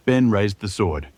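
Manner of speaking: plain reading voice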